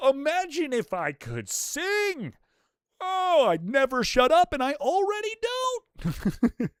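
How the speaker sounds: high, drawn-out mock exasperation; over-the-top ranting. Real, not acted. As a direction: stretch it out, climbing into a high wail. Mock rant about singing nonstop.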